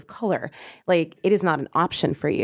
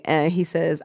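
normal talking voice

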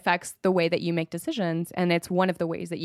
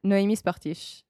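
Noémie Sportiche in a native French accent; 2 semitones higher, slower than usual. Shown as a demonstration. Guest saying her own name on request, French accent. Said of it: "say it with a French accent".

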